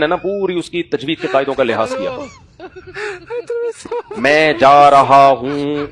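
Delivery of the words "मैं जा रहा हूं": मैं जा रहा हूं drawn out very slowly and louder; slow careful diction. Shown as a demonstration. Teacher showing proper slow Urdu diction. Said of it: "ऐसे नहीं कि".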